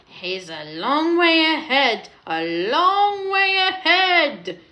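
hammed-up storybook wail. A narrator's reading. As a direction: lament that rises and stretches. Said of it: "they wailed".

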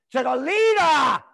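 furious shouted jab; angry shout. Real, not acted. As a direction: yell it in disgust. Angry rant about lockdowns.

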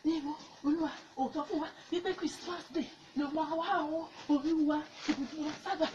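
hushed, frantic pleas in short bursts; panicked prayer. Acted. Evangelist fleeing, begging Jesus to save, panicked prayer. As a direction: beg repeatedly for rescue under your voice.

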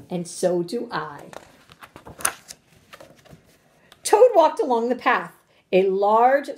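animated, emphatic storybook reading; story read-aloud to children. Acted. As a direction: punch key words, lingering on descriptive ones.

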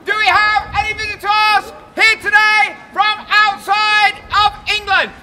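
booming public proclamation; town crier. Acted. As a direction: proclaim it to the whole square. Welcomes crowd as town crier.

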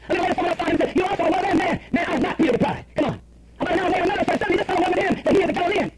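high, emphatic Bible reading; dramatic scripture reading. Acted. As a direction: read the verse out like a preacher. Reads scripture aloud dramatically.

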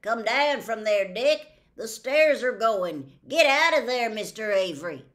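loud urgent shout up to the window; men shouting. A narrator's reading. Men shouting to Mister Avery in the burning house, loud. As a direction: yell a warning up at him. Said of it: "until men shouted".

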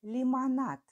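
dictating. Shown as a demonstration. Dictation, word said twice.

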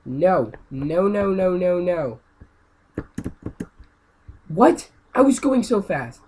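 high, loud repeated no; frustrated shouting. Real, not acted. Gamer crashing while going fast, loud yells. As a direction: yell no as you crash. Dying again in game.

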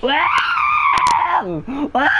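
high shouted yelps, hammed up; mock Hulk yell. Cousin after the fight, yelling like the Hulk. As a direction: bellow it like a raging Hulk.

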